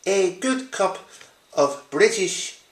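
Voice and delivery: mock British accent, slow and measured; bad British accent. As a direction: put on your worst British accent. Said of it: "that's my worst British accent".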